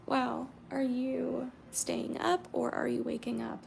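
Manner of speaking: normal talking voice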